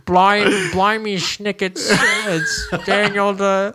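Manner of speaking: high, hammed-up British voice; bad British accent. Cohost clowns as a British earl in a bad accent. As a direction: ham up a British aristocrat. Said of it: "That's my best".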